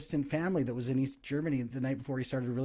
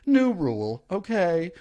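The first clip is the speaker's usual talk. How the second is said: bad secondhand talk-show host impression; louder, punchy host announcing a segment; Bill Maher; 4 semitones higher, 5 dB louder, slower than usual. Shown as a demonstration. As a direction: put on a rough host voice. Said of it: "shitty impression of all Kyle's impressions".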